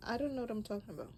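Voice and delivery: usual speaking voice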